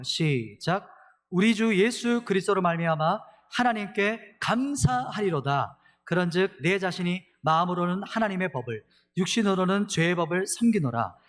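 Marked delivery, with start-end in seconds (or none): 1.3-11.2 proclaiming scripture. Real, not acted. Pastor leading the congregation in proclaiming the verse. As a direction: read it out as a proclamation. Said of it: "같이 한번 선포할까요".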